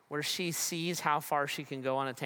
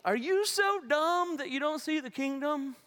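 high, scornful scolding voice, pushed up and louder; mock scolding; 10 semitones higher, 4 dB louder than usual. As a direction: sneer it like a nagging scold. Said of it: "It's not a scolding".

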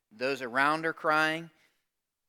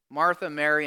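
ordinary talk, nothing marked

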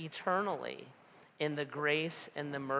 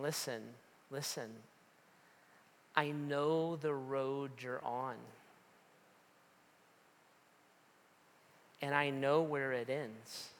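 softer and slower, tender voice of God; gentle warning; 4 dB quieter than usual. Acted. God speaking to a wayward listener, gently and softly. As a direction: warn kindly, like a caring father.

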